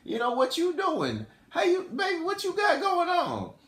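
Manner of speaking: smooth-talking come-on, climbing high; soft opener sliding into high playful sweet talk. Retold or imitated. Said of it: "or [name]'s like".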